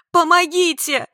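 high, loud yell; scream for help. A narrator's reading. Woman filling her lungs, screaming for help. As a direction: shout for rescue at full voice. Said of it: "я закричала".

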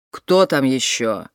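low, slow sleepy man grumbling. A narrator's reading. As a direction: grumble it from inside a tent. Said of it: "пробурчал мужской голос".

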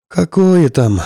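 joyless scoff. Acted. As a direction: scoff it, without cheer. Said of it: "невесело хмыкнул пилот".

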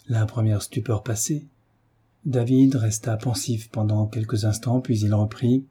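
ordinary delivery, nothing marked

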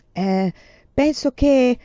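halting, slow, stumbling start; he can't get the words out; hesitant. A narrator's reading. Embarrassed young man struggling to confess, slow and halting. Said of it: "si schiarì la voce".

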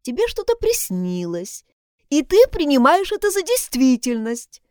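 lightly voiced condescending nurse. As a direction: insist it was only a dream. Nurse lectures the girl.

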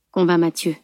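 ordinary delivery, nothing marked

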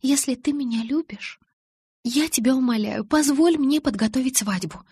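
high-pitched, insistent begging; coaxing, imploring plea; pleading friend. A narrator's reading. Alice begging to plan wedding.

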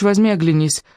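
ordinary delivery, nothing marked

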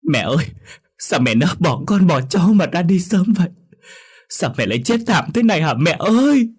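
high, loud grief-stricken wail. Acted. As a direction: cry out in lament over a dead mother, drawing out the final call. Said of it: "anh lại gào khóc to hơn". (gào khóc to, than khóc thảm thiết)

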